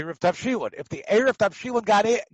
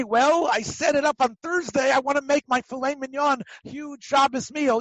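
mock excuse; 7 semitones higher than usual. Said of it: "You can't say".

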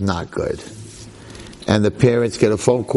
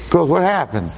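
normal talking voice

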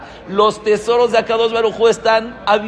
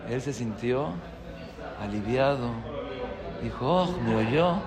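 hushed, low and much softer; quiet relief; 7 semitones lower, 12 dB quieter than usual. Retold or imitated. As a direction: drop right down, as if relieved at being heard. Retelling the man's quiet relief.

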